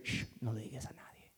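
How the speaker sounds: whispered shh, secretive; hushing whisper. Acted. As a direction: hush them in a whisper. Jesus hushing his disciples.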